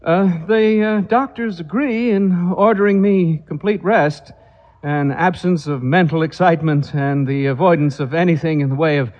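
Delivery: high, halting and nervous, with uh's; a jittery guest fumbling for words; nervous invalid clearing his throat to talk about his health; nervous hesitant man. Acted. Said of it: "cleared his throat nervously".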